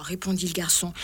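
usual speaking voice

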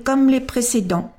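usual speaking voice

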